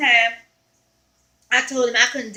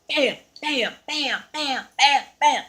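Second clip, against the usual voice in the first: sharp mock slap sounds, bam bam; imitated smacks; slower than usual. Woman reenacting her ex smacking her, imitated slaps. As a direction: slap noises acted out with the mouth. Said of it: "he began to smack me".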